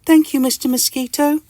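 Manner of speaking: very high, grateful little voice; Mister Itch. Acted. Mister Itch accepts soup when sick, high grateful voice. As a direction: squeak a thank-you. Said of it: "says Mister Itch".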